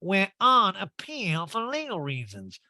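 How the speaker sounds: slow, high, drawn-out mock repeat; Erika. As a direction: spell it out word by word, affectedly. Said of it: "Erica just speaks in law talk now".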